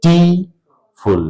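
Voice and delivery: Beautiful very slowly, a gap after each syllable; broken into syllables. Shown as a demonstration. Teacher proving the word has three syllables, beautiful slowly split in three. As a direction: stretch beautiful out as বিউ, টি, ফুল. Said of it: "তিনটে সিলেবলে ভাঙবে".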